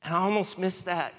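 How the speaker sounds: tearful. Real, not acted. Preacher tells the congregation about God, tearfully. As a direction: push through real tears of awe. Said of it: "I'm up here crying".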